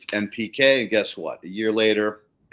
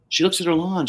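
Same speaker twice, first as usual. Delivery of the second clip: usual speaking voice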